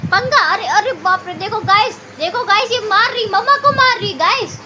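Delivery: high, loud mock alarm; playful outcry. Real, not acted. Mother telling viewers the toddler is hitting her, playful alarm.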